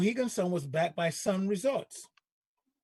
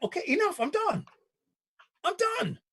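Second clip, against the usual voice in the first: exasperated; 9 semitones higher than usual. Retold or imitated. Exasperated retold reaction.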